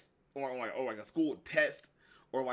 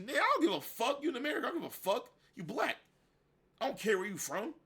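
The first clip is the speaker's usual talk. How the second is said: dismissive; 8 semitones higher than usual. Acted. Heated reply to imagined objector.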